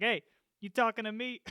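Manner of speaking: put-on mob swagger, half laughing; mobster. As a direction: challenge them like a movie mobster. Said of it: "that mobster thing".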